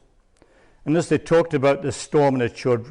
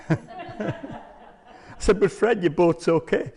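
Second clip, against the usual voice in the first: laughing; 5 semitones higher, much slower than usual. Real, not acted. Laughing while telling the punchline.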